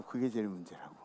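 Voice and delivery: preacher echoing the line softly to let it sink in, quiet. Shown as a demonstration. Soft low echo.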